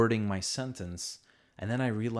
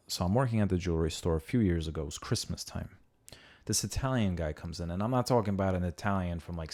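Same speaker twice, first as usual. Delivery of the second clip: ordinary delivery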